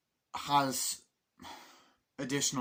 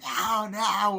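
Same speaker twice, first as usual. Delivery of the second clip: high, loud mock cry of protest; imitated frightened wail; acted pleading; 10 semitones higher, 8 dB louder than usual. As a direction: plead like a panicking villager. Acts out villagers pleading.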